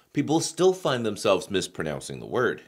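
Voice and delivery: usual speaking voice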